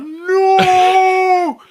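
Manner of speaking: long, high heroic cry; hammed-up movie-hero scream of horror; Kevin Costner. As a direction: stretch out a dramatic mock film yell. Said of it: "I was like Kevin Costner".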